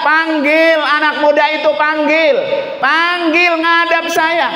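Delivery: high, commanding preacher's cry; angry king. Acted. Furious king orders the young man brought in, acted anger. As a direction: summon him in an enraged, high-pitched voice. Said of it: "Saking emosinya".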